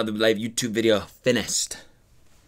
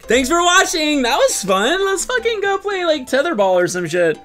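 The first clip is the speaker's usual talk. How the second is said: high, loud, breezy mock kid; mock kid voice, higher and louder; carefree kid impression; 4 dB louder than usual. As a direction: toss it off like an oblivious kid heading to recess. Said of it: "They're just like".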